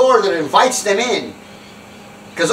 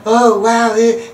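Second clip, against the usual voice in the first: higher, louder wowed crowd; mock amazement; 5 semitones higher, 5 dB louder, much slower than usual. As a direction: imitate dumbstruck onlookers. Onlookers' awe, retold.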